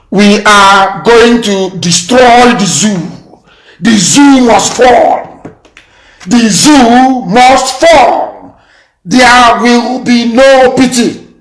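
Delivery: each word dropped slowly and heavily; slow defiant vow. Real, not acted. Warning the government live on air, slow and heavy. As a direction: spell out the threat word by word, very slow.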